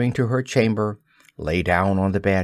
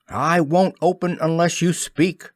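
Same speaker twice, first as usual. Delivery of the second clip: cried out; 6 semitones higher than usual. A narrator's reading. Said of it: "Peter cried".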